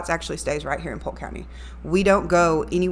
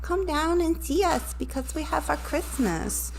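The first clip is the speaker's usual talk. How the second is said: put-on little kid voice, much higher and softer; 10 semitones higher, 5 dB quieter than usual. Shown as a demonstration. Radio host demos her little kid voice for listeners. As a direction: sound like a small child inviting everyone. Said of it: "I also do a little kid's accent".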